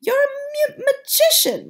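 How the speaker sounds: high, loud stumbling cry of delight; grandmother shouts. Acted. Grandmother praises grandson, high delighted shout. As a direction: trip over the word in joy. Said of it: "my grandmother shouted".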